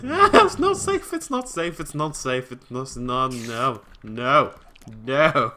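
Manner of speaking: fading frantic cries; panicked. Real, not acted. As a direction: panic then trail off. Creeper at the door.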